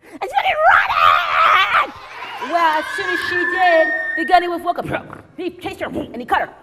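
loud, high, breathless chase telling; excited shouting narration. A narrator's reading. Storyteller to children, shouting the chase excitedly.

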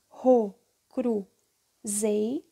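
"Ro, cru, zei" one syllable at a time; sílabas separadas. Shown as a demonstration. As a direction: pause after each syllable of "Ro, cru, zei". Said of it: "formar com isso daí". ("Ro, cru, zei" sílaba por sílaba, com pausas)

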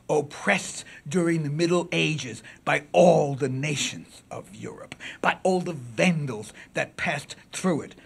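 slow, loud, ringing declamation; powerful oration. Acted. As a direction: swell into a forceful courtroom indictment. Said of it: "suggest something of its power".

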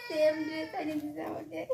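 laughing through happy tears; giggle, almost crying; laughing tearfully. Real, not acted. Overjoyed at gift from husband. (আনন্দে হাসি-কান্না)

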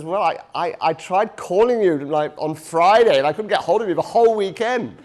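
comic doctor apologising; sheepish doctor. Acted. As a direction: deliver the punchline with a chuckle. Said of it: "And the doctor says".

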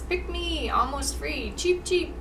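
high little hamster sales pitch; high-pitched pet-shop plea; pleading hamster. Acted. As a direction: pipe up like a hamster begging to be bought. Hamsters on sale plead to buyers.

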